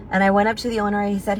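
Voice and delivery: normal talking voice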